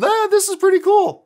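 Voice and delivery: high, bright and loud, delighted; impressed. Retold or imitated. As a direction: let it burst out, impressed.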